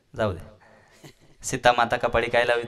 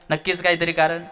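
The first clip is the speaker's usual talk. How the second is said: ordinary talk, nothing marked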